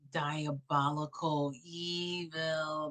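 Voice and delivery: quiet gleeful menace; slow savoring. Real, not acted. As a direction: drag out each word with relish. Savoring the words slowly.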